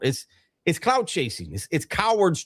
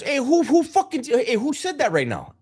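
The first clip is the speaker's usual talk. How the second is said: higher pitch, yelling who said it; angry callout; 10 semitones higher than usual. Retold or imitated. Cellmate calling up the tier, higher and heated. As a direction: demand to know who shushed him. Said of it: "my Sally goes".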